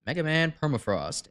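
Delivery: ordinary talk, nothing marked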